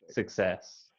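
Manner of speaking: usual speaking voice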